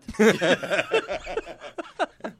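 real high, loud laughter; laughing. Real, not acted. Comic retelling church outburst, high loud laugh. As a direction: burst out laughing at your own story.

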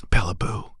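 put-on high, soft whispered baby talk; tender whisper. Father whispering to his newborn daughter, tender. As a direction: breathe it gently over a newborn.

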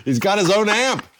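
high-pitched mock awe; mock amazement. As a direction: marvel at it in jest. Hamming up being impressed.

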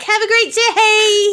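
high, slow sugary fake politeness; mock sweet brush-off. Host imagines sweetly dodging the attacker, sugary fake cheer. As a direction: chirp it sweetly to dodge the fight. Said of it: "I would just be like".

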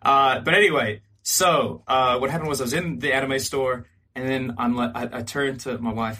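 usual speaking voice

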